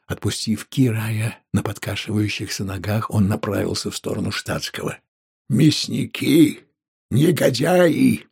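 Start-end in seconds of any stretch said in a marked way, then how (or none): 5.5-8.3 high, drawn-out bitter cries; anguished accusation. A narrator's reading. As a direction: hurl each insult slowly at the killers. Innkeeper cursing the killers. (протяжно, с гневным обличением)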